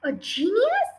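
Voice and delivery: high, rising cry of disbelief; mildly shouted, sharply rising question; incredulous shout. A narrator's reading. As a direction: throw it back as a scandalised question. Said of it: "She shouted".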